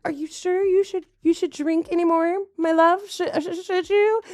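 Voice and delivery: high, halting, over-sweet wifely question; exaggerated pleading politeness, stammering at the end; mock timid coaxing. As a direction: mimic someone tiptoeing round a touchy partner.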